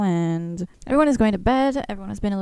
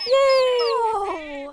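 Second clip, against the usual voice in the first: long, high yay; drawn-out high-pitched cheer; delighted cheer; 13 semitones higher than usual. Real, not acted. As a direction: draw out a high squeal of joy. Cheering at the hatching.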